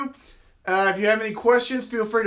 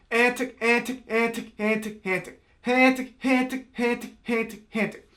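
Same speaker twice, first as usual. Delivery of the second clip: quick vocal hen calls with a T ending; bouncing hen call; 3 semitones higher than usual. Shown as a demonstration. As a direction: chant the duck call notes rhythmically. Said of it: "a T sound at the end of each one of those".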